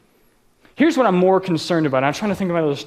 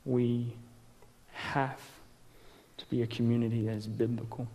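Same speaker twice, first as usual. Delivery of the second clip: hushed earnest; 6 semitones lower, 16 dB quieter than usual. Real, not acted. Solemn point about biblical community.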